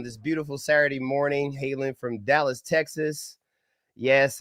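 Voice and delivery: ordinary talk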